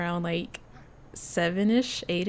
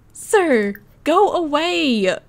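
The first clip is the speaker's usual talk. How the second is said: louder, higher, drawn-out "go away" at x0.5; mock shooing; 6 semitones higher, 7 dB louder, much slower than usual. Real, not acted. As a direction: playfully order him off, stretching it. Shooing the stranger sim.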